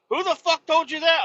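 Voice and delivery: retelling a worker yelling at the driver; angry retold yell. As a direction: bark it like an irate worker.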